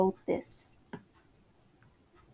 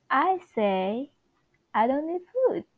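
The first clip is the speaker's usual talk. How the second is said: put-on Wanda's accent; 6 semitones higher, 4 dB louder than usual. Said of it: "Wanda Maximoff's accent".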